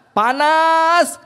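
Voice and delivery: panas rising high and loud; stressed heat. Real, not acted. Preacher stressing panas to the congregation, high and loud. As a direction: hit panas hard and high.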